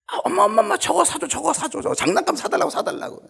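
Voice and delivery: put-on high, loud kid pleading for a toy; child whining at the supermarket; whiny begging child. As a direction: beg mom like a spoiled child. Child begging mother in store.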